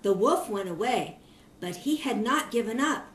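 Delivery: ordinary delivery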